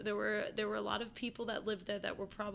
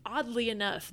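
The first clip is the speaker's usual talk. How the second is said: usual speaking voice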